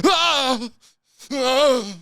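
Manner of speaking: high, loud yelps of pain; freeing the zipper, loud pained yelps. Retold or imitated. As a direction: yelp like you just got caught. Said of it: "just went".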